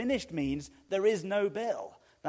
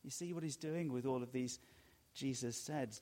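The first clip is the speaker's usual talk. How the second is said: usual speaking voice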